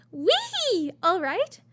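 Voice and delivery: high, loud, playful squeal; reading all-caps aloud with vocal flourish; capitalized whee. Acted. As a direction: let out a big cheering whee. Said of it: "I don't know why I said it like that".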